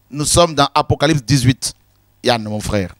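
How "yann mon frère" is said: ordinary talk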